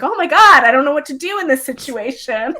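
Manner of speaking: mock starstruck panic; starstruck freak-out. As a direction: act out panicking on meeting an idol. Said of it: "I would probably scream".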